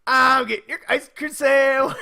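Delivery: put-on high, loud gloating kid voice; gleeful little sister. Charlie gloats to her big sister, high and gleeful. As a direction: rub it in with glee. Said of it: "turns to her sister and goes".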